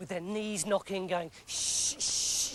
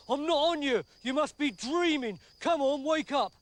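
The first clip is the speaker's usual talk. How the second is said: raised, higher wake-up call; exasperated; 5 semitones higher, 3 dB louder than usual. Acted. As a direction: shake a sleeper awake, fed up. Second soldier waking the first.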